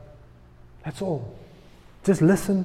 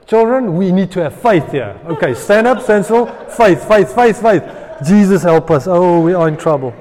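frantic rallying; 7 dB louder than usual. Acted. Frantic leader rallying frightened people.

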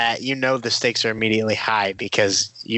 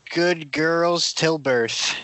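slow, drawn-out mock title; slow deliberate title; 5 semitones higher, much slower than usual. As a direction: announce it slowly.

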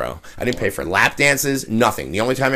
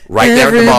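loud excited burst; 11 semitones higher, 8 dB louder than usual. Retold or imitated. Recalls singing at the bar.

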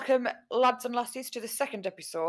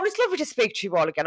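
normal talking voice

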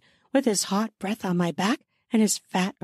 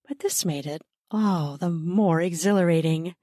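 ordinary delivery

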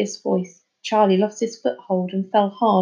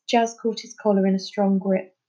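ordinary delivery

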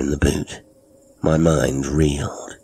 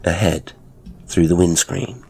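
ordinary delivery

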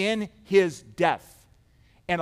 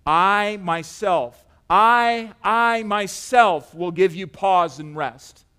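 "I myself" drawn out slowly with heavy weight; slow, forceful stress on "I" and "myself"; emphatic I MYSELF; 5 dB louder, much slower than usual. Acted. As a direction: lean hard on each "I", stretching it. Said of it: "It's so emphatic".

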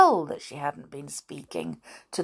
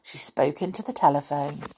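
ordinary delivery, nothing marked

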